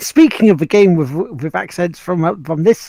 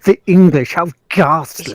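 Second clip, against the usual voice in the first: posh English; 5 dB louder than usual. Retold or imitated.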